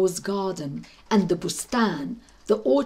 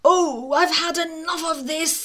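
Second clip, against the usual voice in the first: higher, put-upon cat voice, weary and disdainful; fed-up cat; 7 semitones higher than usual. Acted. Grumbling cat fed up with the old woman's hovel, higher and disdainful. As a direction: sigh it out like a spoiled house cat. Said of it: "says the old woman's cat".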